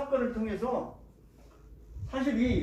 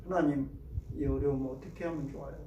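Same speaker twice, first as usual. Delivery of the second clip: softer, lower pleading prayer; quiet prayer; 7 semitones lower, 5 dB quieter, faster than usual. Retold or imitated. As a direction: plead humbly to God. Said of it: "무릎 꿇고 하나님께 기도하죠". (무릎 꿇고 하나님께 드리는 작고 낮은 기도)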